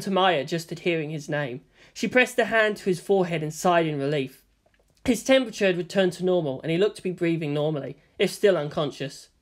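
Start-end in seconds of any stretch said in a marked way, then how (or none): none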